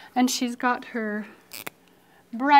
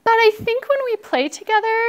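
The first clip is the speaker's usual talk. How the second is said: very high, loud put-on voice; pushy storybook girl voice; bossy character; 12 semitones higher, 7 dB louder than usual. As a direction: wheedle loudly, way up high. Bossy R bargains, acted.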